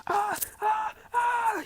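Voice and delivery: high-pitched mock screams of pain; mild high retold scream. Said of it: "He's, like, screaming in pain".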